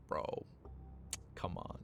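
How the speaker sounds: hushed, low "come on"; quiet disbelief. Real, not acted. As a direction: mutter it in disbelief.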